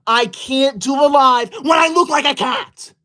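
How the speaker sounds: exasperated shout. Real, not acted. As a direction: yell it in frustration. Protesting the cat-ear filter.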